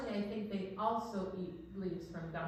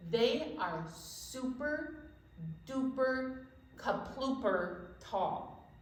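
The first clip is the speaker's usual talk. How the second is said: slow, drawn-out wonder; much slower than usual. Acted. Stretching words to wow kids.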